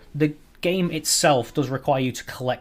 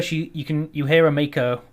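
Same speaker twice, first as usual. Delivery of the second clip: ordinary talk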